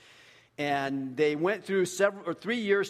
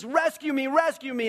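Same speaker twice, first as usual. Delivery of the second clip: high, loud mock plea; acted cry for help, pitched high; 9 semitones higher, 5 dB louder than usual. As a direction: call out urgently for rescue. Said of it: "He cries out to Jesus".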